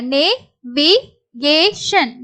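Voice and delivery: slow syllables with gaps. Shown as a demonstration. Teacher introduces the next word, syllable by syllable. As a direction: break "navigation" into four separate pieces.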